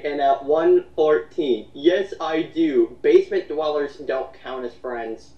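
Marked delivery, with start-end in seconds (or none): none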